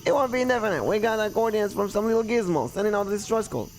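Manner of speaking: high, earnest character voice; persuasive local. Acted. Local explaining the distress call to the owner, persuasive.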